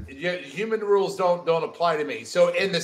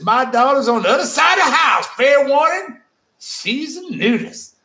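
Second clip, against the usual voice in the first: loud, higher hammed-up Southern client; Southern accent; 8 semitones higher, 5 dB louder than usual. Reading the client's warning in an overdone Southern accent. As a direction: shout it out in a big fake Southern twang. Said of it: "both accents, you nailed it".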